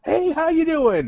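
high, drawn-out singsong hello; imitated effusive greeting. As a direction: break off to greet a passerby, hammed-up. Sam greets someone walking by.

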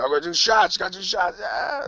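high-pitched mock rocker yelp; hammed-up party holler; air-guitar party guy. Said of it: "Got the air guitar going".

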